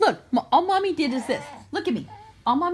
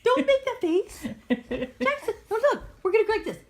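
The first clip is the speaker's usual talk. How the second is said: put-on high baby talk; 7 semitones higher than usual. Mom coaxing baby Jackson not to cry, high.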